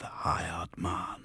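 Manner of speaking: sudden eerie whisper; possessed whisper. A narrator's reading. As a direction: drop to a hiss of a spirit. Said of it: "deep and slow and weirdly glutinous".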